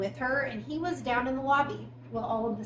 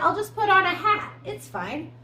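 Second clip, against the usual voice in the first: higher, breezily overconfident mock quote; cocky; 8 semitones higher, 5 dB louder than usual. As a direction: imitate a cocky guy shrugging it off. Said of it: "He's so cocky".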